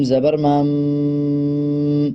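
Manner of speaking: مم held long through the nose; slow nasal ghunna on مم. Shown as a demonstration. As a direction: hum مم slowly through the nose. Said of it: "اس میں پھر غنہ کیا جائے گا".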